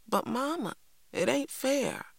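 put-on high, plaintive child's protest. A narrator's reading. Cassie complains to Mama about being wronged, protesting. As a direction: plead unfairness in a high voice.

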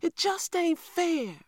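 put-on high, slow, drawn-out child's wail; a small boy wailing his protest, pitched high; wailing little boy. A narrator's reading. Little boy wails to grandmother that it isn't fair, high and slow. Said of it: "wailed Little Man".

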